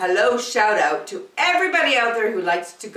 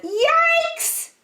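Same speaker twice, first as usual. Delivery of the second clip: a much higher squeak of alarm; a little girl's sudden fright; startled yelp. Acted. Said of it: "Angela said".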